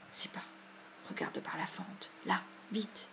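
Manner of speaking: hushed, urgent whisper; secretive, breath-only urgency; frightened whisper. A narrator's reading. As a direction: whisper so nothing hears you. Frightened boys hiding from howling dog.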